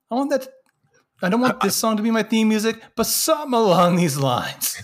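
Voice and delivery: mock eager fan. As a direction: gush like a fan with a new idea. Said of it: "being like".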